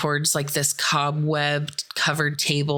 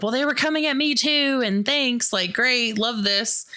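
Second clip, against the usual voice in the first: high, hurried sarcastic retort; put-on squabbling, pitched up; mock bickering; 8 semitones higher than usual. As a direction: argue back in exaggerated indignation. Acted quarrel over whose fault.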